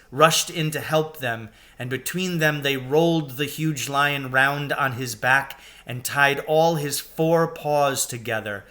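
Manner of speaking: ordinary delivery, nothing marked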